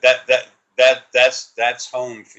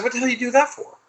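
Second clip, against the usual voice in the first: much higher, strained put-on outrage; mock outraged yell; faster than usual. Scolding his energy for knocking out the power, high mock yell. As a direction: squeal it up high like a cartoon tantrum. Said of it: "And I said".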